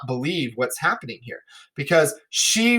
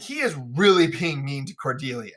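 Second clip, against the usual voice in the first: normal talking voice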